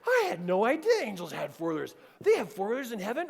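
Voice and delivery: put-on excited kid, high and loud; Cameron. Son marvels at angels on four-wheelers, excited. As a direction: imitate a boy gushing in amazement. Boy's amazed chatter.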